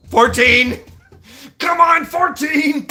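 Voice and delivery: high, loud mock-desperate shout; hammed-up pained holler; desperate counting. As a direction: yell the count like a kid begging it to end. Child shouts count during retold whipping.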